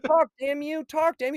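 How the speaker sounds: high, loud imitation of yelling; mock furious demand. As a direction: shout it as a desperate threat. Retold threat to the doll.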